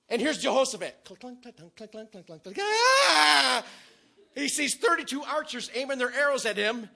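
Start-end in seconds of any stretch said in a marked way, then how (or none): 1.1-2.6 quiet, rapid clanking noises; imitated clanking. Imitating the clatter around Jehoshaphat, softly clanked out. 2.6-3.6 loud, high scream of panic; terrified mock scream. Jehoshaphat facing thirty-two archers, screaming in mock terror. Jehoshaphat sees arrows aimed at him.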